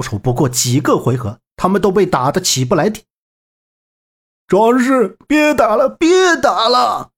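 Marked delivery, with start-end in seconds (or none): 4.5-7.1 high, drawn-out pleading cry; begging for mercy. Acted. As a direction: cry out as a beaten bandit. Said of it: "贼人开始求饶". (高声哀叫求饶)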